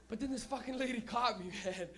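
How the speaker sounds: ordinary talk, nothing marked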